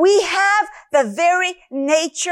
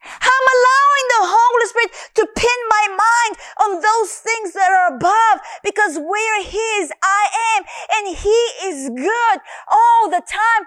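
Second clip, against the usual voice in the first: fervent cry; 7 semitones higher than usual. Real, not acted. Fervent testimony climax.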